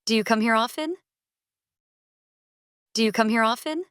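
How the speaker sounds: usual speaking voice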